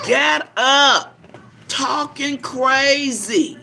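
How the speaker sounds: loud, slow scold; drawn out, telling her off. Real, not acted. Grandmother scolding her grandbaby to get up.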